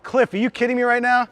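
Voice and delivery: loud, high, thrilled greeting to a friend; excited shout. Real, not acted. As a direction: shout it out, overjoyed to see him. Greeting friend who arrived, excited.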